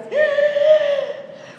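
put-on sharp high gasp like a toddler catching breath; a child's startled intake of breath before a scream; mock toddler gasp; imitated baby gasp. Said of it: "The kid goes".

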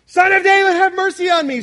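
high, loud desperate shout; desperate crying out. Retold or imitated. Blind Bartimaeus crying to Jesus, loud desperate shout. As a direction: scream it out for mercy. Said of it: "he kept crying all the more".

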